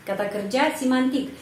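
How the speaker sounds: normal talking voice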